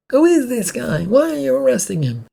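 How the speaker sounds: high, loud shout from the crowd; a bystander calling out to the cops, loud and high. Acted. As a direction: yell the questions out, pitched high. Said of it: "someone in the crowd shouted".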